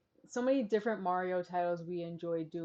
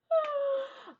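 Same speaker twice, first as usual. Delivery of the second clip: high-pitched laugh; 3 dB louder than usual. Real, not acted. Laughing at the penguin army.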